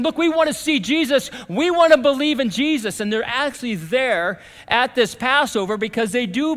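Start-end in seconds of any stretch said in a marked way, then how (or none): 0.0-3.0 high, insistent plea; raised, urgent retold request; eager seekers. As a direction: voice eager newcomers, pitched up and louder. Voices Greeks eager to see Jesus.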